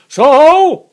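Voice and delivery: very loud, high sung-out shout; roaring call. A narrator's reading. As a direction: bellow it out as far as it carries. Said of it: "sang out as loud as he could roar".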